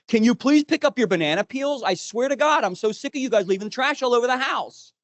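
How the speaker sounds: put-on high, loud exasperated yell at the kids; fed-up dad yelling at the kids. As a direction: snap at the kids over the banana peels. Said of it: "I yell at my kids".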